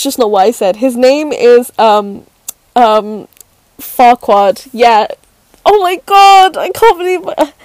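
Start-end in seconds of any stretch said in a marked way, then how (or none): 5.6-7.5 high, loud flustered exclamation; mortified disbelief. Real, not acted. Speaker aghast she named him on air, mortified. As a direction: exclaim in horror at yourself.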